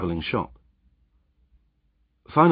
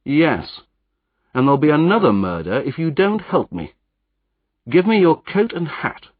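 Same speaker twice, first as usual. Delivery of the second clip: louder, higher, urgent commands; threatening; 7 semitones higher, 5 dB louder than usual. Acted. Hero threatens Jopley and demands his coat, urgent and loud. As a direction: threaten him into obeying.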